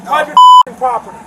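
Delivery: shouting at trespasser. Real, not acted. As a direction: shriek it out in rage. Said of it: "Why are you yelling?".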